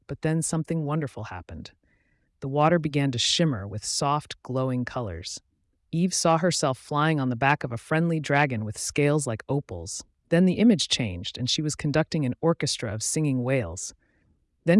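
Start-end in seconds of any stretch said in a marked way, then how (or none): none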